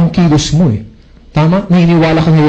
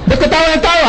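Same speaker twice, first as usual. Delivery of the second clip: high, exasperated question; indignant demand; 12 semitones higher, faster than usual. Retold or imitated. The jilted man scolding the laughing stranger, indignant and high. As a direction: demand an answer, fed up.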